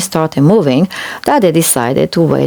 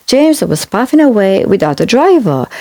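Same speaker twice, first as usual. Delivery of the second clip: usual speaking voice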